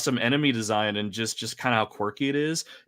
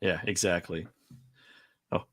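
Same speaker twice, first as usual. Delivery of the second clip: ordinary talk, nothing marked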